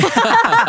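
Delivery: high, loud amused laugh; burst of laughter. Real, not acted. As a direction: laugh out at the joke. Teasing about who got the turtle. (tertawa lepas)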